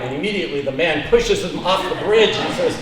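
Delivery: usual speaking voice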